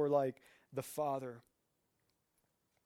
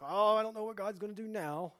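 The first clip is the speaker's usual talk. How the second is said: higher-pitched worried wail; hammed-up anxious moan; mock panic; 7 semitones higher, 3 dB louder, faster than usual. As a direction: fret aloud in exaggerated dread. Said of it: "these situations where it's terrifying".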